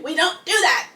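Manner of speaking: loud, insistent scolding of mom; firm scolding. Retold or imitated. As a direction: lay down the law with mom. Retelling telling mom off.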